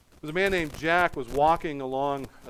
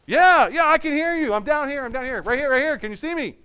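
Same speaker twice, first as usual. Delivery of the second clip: frantic reply; 10 semitones higher, 8 dB louder than usual. Acted. Jack answers God, panicked.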